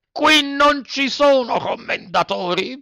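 high, slow, hammered denial; harsh retort, topping it. A narrator's reading. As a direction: bang out each word harder. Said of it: "replicò duramente".

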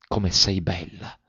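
soft, slow, adoring wonder; enamoured exclamation. A narrator's reading. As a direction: breathe out admiration slowly and softly. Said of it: "esclamò guardandola con occhi innamorati". (esclamazione innamorata, lenta e sommessa)

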